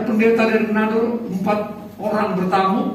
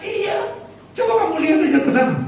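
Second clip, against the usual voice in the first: laughing reassurance; 7 semitones higher, faster than usual. Retold or imitated. Pastor's wife laughs off the fear.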